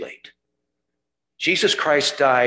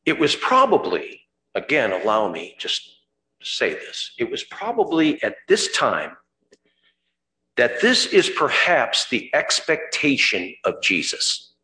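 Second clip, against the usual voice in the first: ordinary delivery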